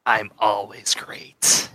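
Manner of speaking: slow, self-satisfied drawl; smug slow Steven. Acted. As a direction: purr it out smugly, slow. GM voices Steven.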